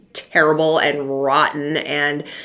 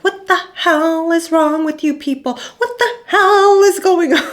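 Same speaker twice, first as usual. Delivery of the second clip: much higher exasperated comedian rant; Sebastian Maniscalco impression; 12 semitones higher, 4 dB louder than usual. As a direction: rant like an outraged stand-up. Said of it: "in his stand-up, he's always like".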